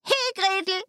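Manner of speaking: high-pitched witch's call. A narrator's reading. Impatient witch to the girl, high call. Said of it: "rief sie dem Mädchen zu".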